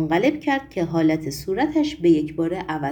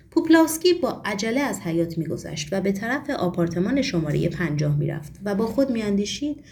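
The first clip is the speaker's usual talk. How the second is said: plain reading voice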